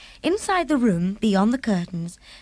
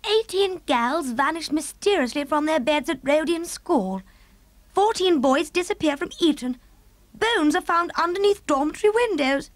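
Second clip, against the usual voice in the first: higher, appalled regal voice; the Queen reading; 8 semitones higher than usual. Acted. Queen reading the newspaper headlines, higher and appalled. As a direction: read it out in rising dismay.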